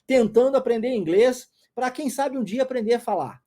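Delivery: loud, high, eager student shouting out; mock eager student. Teacher voices a pretend student answering his question, eager and loud. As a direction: play a keen student blurting out an answer.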